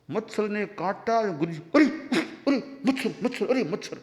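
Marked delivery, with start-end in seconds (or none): 1.8-4.0 mock impatient yelp at a mosquito; flustered, swatting cry of a bothered guru. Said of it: "थोड़े यूं अधीर से हो गए". (अधीर गुरु की झुंझलाई पुकार)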